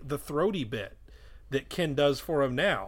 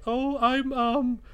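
much higher, timid and hesitant; timid nerdy voice; 11 semitones higher, 5 dB louder, much slower than usual. Retold or imitated. Recalling his early Harkos voice, timid and much higher. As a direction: squeak it out like a shy little nerd. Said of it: "like my voice, but slightly timid and a little higher".

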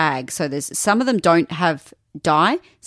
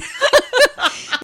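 high burst of laughter; 4 dB louder than usual. Real, not acted. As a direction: laugh out loud. Laughing at friend.

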